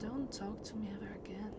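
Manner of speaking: hushed whisper, no voice at all. Acted. To the game characters, cold whisper. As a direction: breathe the words out unvoiced. Repeats line then whispers it.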